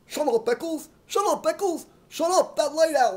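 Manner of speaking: loud, high row of shouts; angry yelling. Acted. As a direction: yell him down. Shouting match continues.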